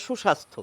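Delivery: সুস্বাস্থ্য said shushastho, single s; সুস্বাস্থ্য, no doubled s. Shown as a demonstration. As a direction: say সুস্বাস্থ্য as shu-shastho. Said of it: "সু লাগালে হয়".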